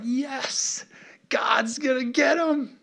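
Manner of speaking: high, drawn-out gleeful cheer; Moses, thrilled. Acted. Moses hearing God will rescue his people, acted out elated. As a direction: celebrate in a high, strained squeal. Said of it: "He's so excited".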